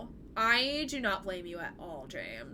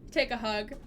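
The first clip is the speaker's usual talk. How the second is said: usual speaking voice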